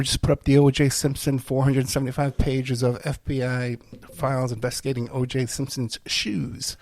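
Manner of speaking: normal talking voice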